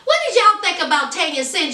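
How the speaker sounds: ordinary delivery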